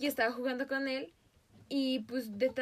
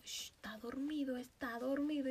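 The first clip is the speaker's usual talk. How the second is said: soft, quieter shushing; hushing whisper; 6 dB quieter than usual. Retold or imitated. Little girl hushing her mom, quietly. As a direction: hush mom so the duck keeps sleeping. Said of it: "Y le digo".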